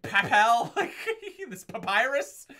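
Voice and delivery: 高めで大きく、ゆっくり皮肉っぽく; mocking quote. Mocking the character's lines.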